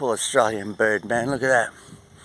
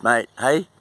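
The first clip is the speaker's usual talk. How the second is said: ordinary talk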